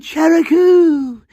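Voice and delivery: long, high drawn-out cry of a name; dramatic yell. Pretend. Yelling to his love burning at the stake, a dramatic high cry. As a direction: cry the name out slow into the sky, high and loud. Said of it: "And I yelled".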